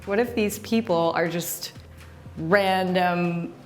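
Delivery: ordinary delivery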